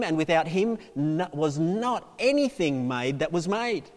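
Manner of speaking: slow, emphatic pulpit proclamation; declaimed scripture. Acted. Preacher reading John to the congregation, declaiming. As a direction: proclaim each phrase with weight.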